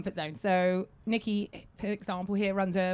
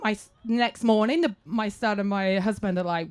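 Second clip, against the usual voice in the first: ordinary delivery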